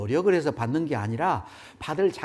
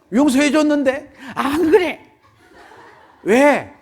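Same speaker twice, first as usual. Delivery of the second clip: put-on child's tearful insistence; 11 semitones higher, 12 dB louder, slower than usual. Child and father, voiced.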